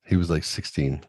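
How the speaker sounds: ordinary delivery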